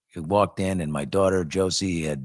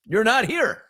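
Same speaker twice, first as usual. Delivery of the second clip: high, gleeful mock of a rival; a hammed-up gloating coworker; 13 semitones higher than usual. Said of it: "So he'd be like".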